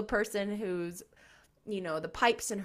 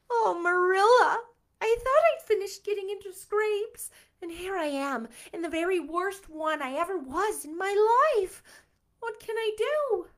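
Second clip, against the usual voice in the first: higher, louder despairing plea; 9 semitones higher, 8 dB louder than usual. Acted. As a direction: plead in a frantic rush. Anne despairing.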